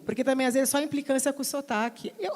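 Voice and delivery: ordinary talk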